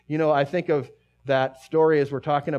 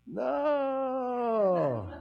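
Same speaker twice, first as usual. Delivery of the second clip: anguished no; 10 semitones higher than usual. Retold or imitated. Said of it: "And Luke goes".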